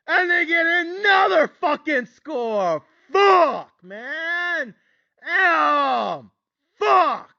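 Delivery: high, drawn-out cries of frustration; anguished outburst. Retold or imitated. Bettor reliving another Stanford score, anguished fury.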